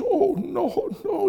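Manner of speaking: high-pitched weepy denial; hammed-up tearful voice; mock crying. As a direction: play the inconsolable mourner. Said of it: "then why are you crying".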